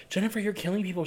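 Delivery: high-pitched quote of a worried friend; Needy. Acted. Quoting Needy confronting her friend, high voice. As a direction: lift the voice high for the film line. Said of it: "Amanda Seyfried's character, um, Needy".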